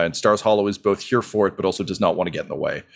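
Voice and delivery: ordinary talk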